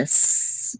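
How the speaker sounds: "S" as a long hissed sss; held S. Shown as a demonstration. Teacher isolates the sound to hold, "S" as a long hiss. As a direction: sustain the bare "S" sound, no vowel. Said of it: "just hold it a little bit".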